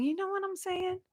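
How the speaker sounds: high, soft put-on voice; lightly voiced playful aside. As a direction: toss it off in a silly voice. Repeated with a put-on voice.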